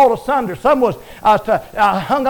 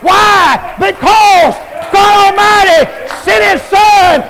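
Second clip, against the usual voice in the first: shouted preaching; 5 semitones higher than usual. Acted. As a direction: drive each line home to the crowd. Preacher builds again, shouting.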